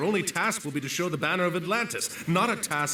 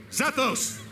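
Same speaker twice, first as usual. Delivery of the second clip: high, carrying call across the deck; calling out orders; 7 semitones higher than usual. Acted. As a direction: summon him from afar. Admiral calling orders to officer.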